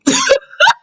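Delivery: a high burst of laughter. Real, not acted. Vlogger cracking up at the diss, high laugh. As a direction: cackle up high.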